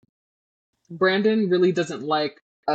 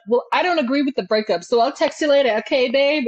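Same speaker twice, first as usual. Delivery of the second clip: higher, fast, sugary brush-off; mock sweet girlfriend; 8 semitones higher, 3 dB louder, faster than usual. Friend mimics the ex dismissing the breakup, higher and sugary. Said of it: "and she's like".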